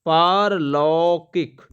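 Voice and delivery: पारलौकिक said very slowly with the long पा held; slow, deliberate teaching voice; slow clear demo. Shown as a demonstration. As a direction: lay out पारलौकिक slowly, syllable by syllable. Teacher drills the correct word.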